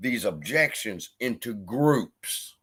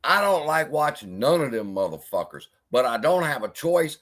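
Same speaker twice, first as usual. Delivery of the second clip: ordinary delivery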